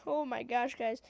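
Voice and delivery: ordinary talk, nothing marked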